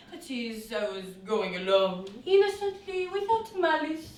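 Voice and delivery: ordinary talk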